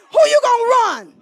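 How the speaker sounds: high, loud preacher's jab; loud challenge. Real, not acted. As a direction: call out the men loudly.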